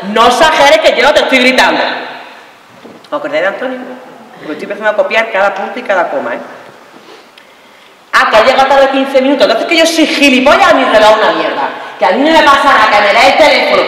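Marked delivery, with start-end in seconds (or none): 0.1-1.8 high, loud indignant protest; hammed-up angry denial; yelling denial of yelling. 8.1-13.8 high, loud sarcastic outrage; hammed-up jealous scolding; indignant quarrel.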